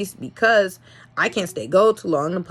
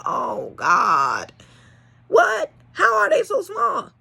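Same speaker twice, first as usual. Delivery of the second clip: higher-pitched, incredulous re-enacted reaction; retold disbelief; 11 semitones higher, slower than usual. Owner seeing tiny newborn puppies, retold disbelief. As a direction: act out being stunned, voice jumping up.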